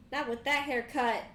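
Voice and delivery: loud, slow burn on the haircut; slow, loud roast of a bully; sassy comeback. Acted. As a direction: land the insult with attitude.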